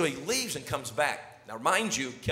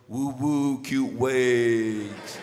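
imitating his son; 4 semitones lower, much slower than usual.

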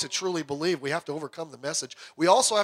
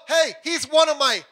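loud, high proud shout; yelled boast; 8 semitones higher, 7 dB louder than usual. Acted. God proudly shouting to the devil about Job, loud and high. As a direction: belt it out like a cheer. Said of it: "I was gonna yell".

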